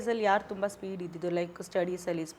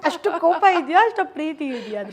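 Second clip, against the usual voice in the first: laughing surprise; 7 semitones higher, 7 dB louder than usual. Real, not acted.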